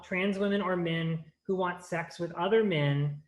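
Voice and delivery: ordinary talk, nothing marked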